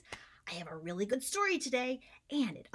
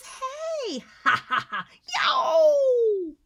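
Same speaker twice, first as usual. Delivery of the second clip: loud mocking laugh of a bully; bully's jeer; 12 semitones higher, 12 dB louder than usual. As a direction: jeer at Preston as you push past. Bully voiced in story.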